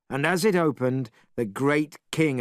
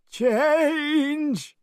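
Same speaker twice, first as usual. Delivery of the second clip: cried spell. A narrator's reading. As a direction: shout the magic word way up high. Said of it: "and cried".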